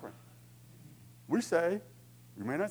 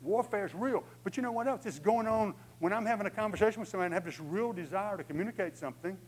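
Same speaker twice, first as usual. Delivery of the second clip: ordinary talk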